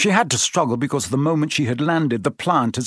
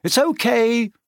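high, drawn-up call; 10 semitones higher than usual. A narrator's reading. Harry to friends at the trapdoor, calling up. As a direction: call up to someone far above. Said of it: "he called up to the light".

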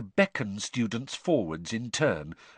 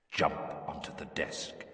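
low, near-whispered command; distant echoing voice; 6 semitones lower, 8 dB quieter than usual. A narrator's reading. Curse pressing the boy to obey, low and hushed. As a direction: insist in a hushed hypnotic undertone. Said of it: "Moody's voice echoing".

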